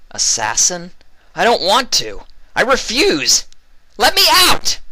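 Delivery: a very high, loud refusal; panicked shouting. A narrator's reading. Vinyl refusing the interrogator, panicked shouting. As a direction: yell it in desperate refusal.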